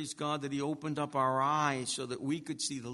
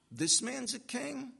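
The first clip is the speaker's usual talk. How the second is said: high, slow scoffing question; arrogant disbelief; 8 semitones higher than usual. Acted. Pilate sizing up Jesus as king, arrogant and incredulous. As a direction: draw it out high, as if the idea is absurd. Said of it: "already showing his arrogance".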